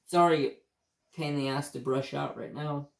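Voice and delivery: ordinary talk, nothing marked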